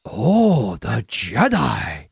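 low, very slow put-on alien accent; slow, low mock alien accent; mock Trade Federation. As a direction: drag it out low and strange. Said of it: "Or the Trade Federation".

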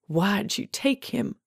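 slow, tense, rising demand. A narrator's reading. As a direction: demand an answer, drawing the words out. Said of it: "she demanded".

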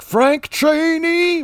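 high, drawn-out exasperated cry; hammed-up, high call of a hated name; mock captain's holler. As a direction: stretch the name out in mock outrage. Mimicking captain who hates the hero.

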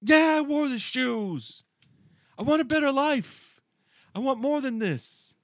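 mock-dramatic cry for a better life; young man begging to escape his trade, high and pleading; impassioned plea. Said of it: "he's like".

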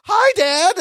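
put-on girly chirp. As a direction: chirp it like a cheerful little girl. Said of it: "she turns and looks and goes".

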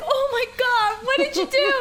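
high, shocked coworker voice; sweet coworker shocked at swollen lips, voiced high; shocked gasp. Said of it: "she's like".

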